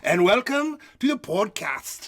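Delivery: badly faked Irish brogue; Irish accent. Host welcomes listeners in a jokey Irish accent. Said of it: "This is my Ireland accent".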